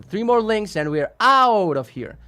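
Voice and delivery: high, slow announcing; announcer call. Real, not acted. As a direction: call it out like an emcee. Announcing to chat.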